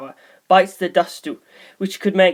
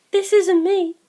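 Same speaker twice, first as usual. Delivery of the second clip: put-on much higher, light young girl voice; Sugimoto's voice; 13 semitones higher, slower than usual. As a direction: lift into a bright girlish voice for her. Narrator voices Sugimoto's lines.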